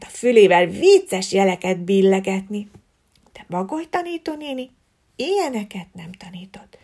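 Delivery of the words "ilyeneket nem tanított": whispered narration aside; hushed aside. A narrator's reading. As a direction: drop to a whisper. Narrator drops to a hush.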